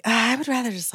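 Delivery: high, mock-reluctant whine. Younger self balking at advice, mock reluctant. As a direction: lightly mimic balking in a high voice.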